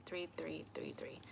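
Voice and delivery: breathy whispered counting. Real, not acted. Whispering the count to viewers. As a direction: whisper it like a secret tally.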